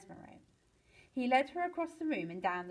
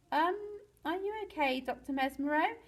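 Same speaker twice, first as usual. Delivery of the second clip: higher-pitched, hesitant question; tentative concern; 6 semitones higher than usual. A narrator's reading. Mister Penguin checks the dazed doctor, tentative. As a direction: ask gingerly, with a rising end.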